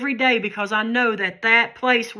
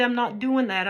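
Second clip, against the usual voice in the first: ordinary delivery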